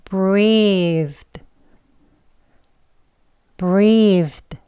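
breathed slowly, long ee into a light d; 천천히. Shown as a demonstration. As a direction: stretch out breathed for learners. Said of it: "천천히 해볼게요". (breathed를 천천히 또박또박)

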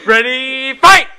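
big rising shout on fight; booming fighting game announcer. Pretend. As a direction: call the round start like an arcade game. Fighting game style match start.

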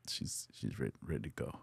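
whispered, soft and unvoiced; hushed whisper. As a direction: breathe the words out under your breath. Telling family mom is dying.

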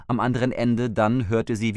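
plain reading voice

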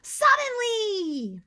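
slow, loud and high exclaimed word; excited. Shown as a demonstration. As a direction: stretch it out with excitement for the kids. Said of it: "here's our word".

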